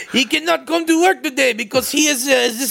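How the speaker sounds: a bad, lightly done Irish accent. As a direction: try an Irish accent and miss. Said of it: "is this my Irish accent?".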